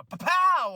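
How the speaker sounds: explosive mock crash noise; imitated impact. Retelling a wrestler hitting the steel steps, imitated impact. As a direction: punch out the impact like a sound effect.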